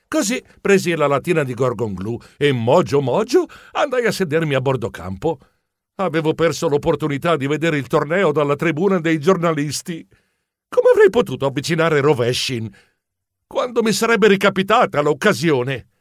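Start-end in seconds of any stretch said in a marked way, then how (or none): none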